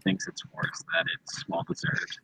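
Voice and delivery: soft whispered aside; whispering. Real, not acted. Whispered remark in chat.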